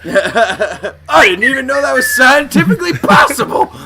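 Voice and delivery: high, loud disbelief; hammed-up shock; mock astonishment. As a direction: cry out like a dumbfounded cop. Plays cop stunned by falling bullet.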